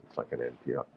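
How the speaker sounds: hushed low radio murmur; podcast voice. Shown as a demonstration. Still mocking podcasters, low and hushed. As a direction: keep it soft and intimate like NPR. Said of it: "podcast voice".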